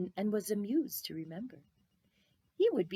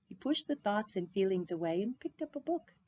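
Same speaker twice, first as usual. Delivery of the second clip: usual speaking voice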